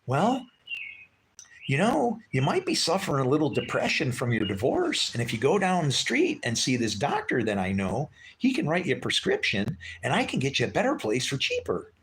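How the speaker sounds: imitating a woman pushing a doctor, high and sweet; imitating her pitch. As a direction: put on her syrupy helpful voice, way up high. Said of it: "She goes".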